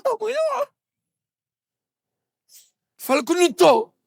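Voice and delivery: put-on high-pitched urgent girlish voice; daughter instructing mother. Daughter asking mother to say she's out, high urgent voice. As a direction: pitch it way up, insistent. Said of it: "Aí ela fala".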